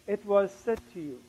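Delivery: hushed, soft retold murmur; small whispering voice. The Lord speaking into his ear, small hushed voice. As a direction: drop right down, as if into an ear. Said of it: "the Lord whispered in my ear, in such a small voice".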